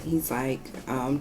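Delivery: normal talking voice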